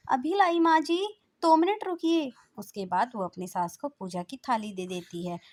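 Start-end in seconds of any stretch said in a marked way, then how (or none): 0.1-2.3 high, loud answering call; calling back. Acted. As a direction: shout back from the kitchen. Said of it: "Aaradhya आवाज लगाती है".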